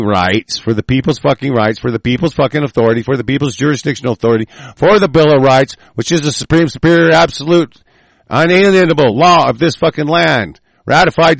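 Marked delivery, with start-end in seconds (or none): 4.7-11.4 rising shout. Real, not acted. Building patriotic tirade.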